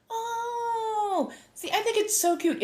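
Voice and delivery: high, drawn-out "aww" voice; cooing fondness. Real, not acted. Streamer reacting to others' cute hobby, high cooing.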